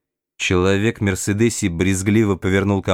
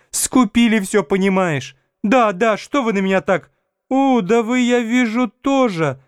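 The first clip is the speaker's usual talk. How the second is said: very high-pitched bickering woman; woman's voice; 12 semitones higher than usual. A narrator's reading. Women bystanders squabbling after the accident, high female voices. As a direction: play a woman in a crowd, pitched far up. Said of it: "женский".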